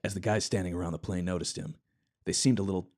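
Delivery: ordinary delivery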